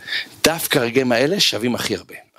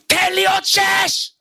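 very high, drawn-out gym yell; coach's shout; slower than usual. Retold or imitated. Coach yelling at a trainee from hall's end, shouting. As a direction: holler it across the hall, high and stretched. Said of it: "שומע אותו צועק עליו".

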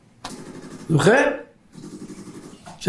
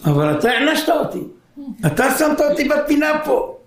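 put-on higher, plaintive child voice; child's protest; 9 semitones higher than usual. Son protesting to his father, plaintive child voice. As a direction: plead like a punished boy. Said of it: "מה אומר לך?".

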